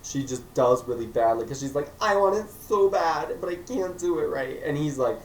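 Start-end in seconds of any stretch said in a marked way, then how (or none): 2.0-4.6 higher, pleading mock whine; whiny. Emma complaining she can't succeed, whiny. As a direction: whine like she wants it badly. Vlogger voices Emma whining.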